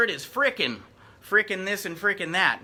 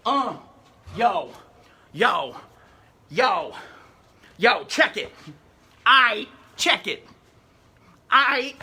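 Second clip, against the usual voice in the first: hammed-up yo yo check it, louder; mock New York rapper; 4 dB louder, slower than usual.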